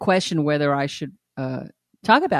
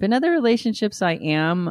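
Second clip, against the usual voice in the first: ordinary delivery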